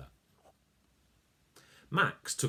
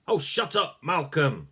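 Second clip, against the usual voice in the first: annoyed; 6 semitones higher, 5 dB louder than usual. Acted. Snapping at Malcolm.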